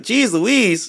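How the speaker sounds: put-on lightly high Canadian accent. Imitating [name]'s Canadian slip on the court, light Canadian accent. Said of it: "sounded like a straight up Canadian".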